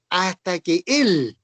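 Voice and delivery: "hasta que Él" slowed right down, weight landing on "Él"; stress on Él. Shown as a demonstration. Teacher showing the congregation the accented "Él", slowly stressed. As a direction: hold "hasta que Él" slow and lean into "Él". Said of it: "Ese Él está con acento".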